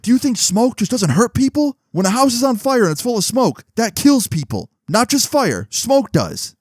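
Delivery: very high-pitched, indignant mock principal; exasperated lecture. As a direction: hammer the point home in a high voice. Principal retold rebutting Henry.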